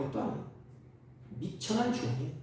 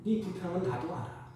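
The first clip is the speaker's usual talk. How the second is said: softer, understanding fatherly reply; gentle God; 6 dB quieter than usual. Retold or imitated. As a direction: soothe a complaining child. God answering the complaint gently.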